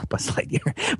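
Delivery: breathy laugh through the name; laughing at own slip. Real, not acted. Guest laughing at his Buzz Lightyear slip, soft and breathy. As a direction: giggle the wrong name softly.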